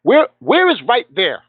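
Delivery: frustrated caller demanding specifics; exasperated. Retold or imitated. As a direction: press the question, drawn out and incredulous. Baffled by vague phone directions.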